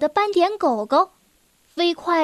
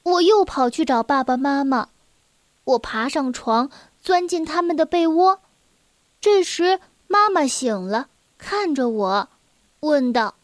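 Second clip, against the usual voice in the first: usual speaking voice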